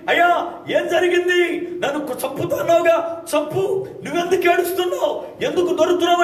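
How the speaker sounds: pleading wife. Acted. Wife pleading with crying husband.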